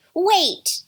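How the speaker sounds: put-on high, loud kid's warning; urgent cry. A narrator's reading. Another kid stops the others, crying out. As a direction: cry out to stop them. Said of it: "cried another".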